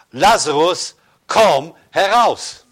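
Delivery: laut und langsam gerufen, gedehnt; lauter Ruf. A narrator's reading. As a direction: wie ein Befehl ins Grab hineinrufen. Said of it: "rief er mit lauter Stimme". (Jesus ruft Lazarus mit lauter Stimme aus dem Grab)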